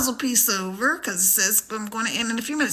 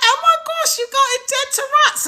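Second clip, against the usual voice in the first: very high-pitched British celebrity impression; hammed-up British accent, pitched way up; mock British accent; 12 semitones higher than usual. Imitating a UK celebrity on a live match, British accent. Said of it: "putting on my British accent".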